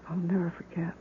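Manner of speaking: a broken whisper of grief; grieving whisper. Acted. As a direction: breathe it out as a private vow. Grieving mother refuses to forget.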